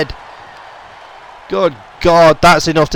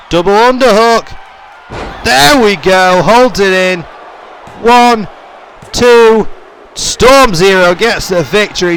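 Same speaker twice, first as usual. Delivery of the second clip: loud and higher through the finish; excited call; 4 semitones higher, 4 dB louder, slower than usual. Real, not acted. Commentator calling the finish and win, loud and excited. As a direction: ride the count up to the win.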